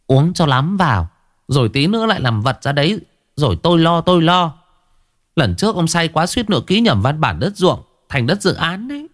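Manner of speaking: high, sharp scolding wife voice; nagging wife. Acted. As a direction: scold him from the kitchen doorway. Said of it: "bà Mến, vợ ông Kính".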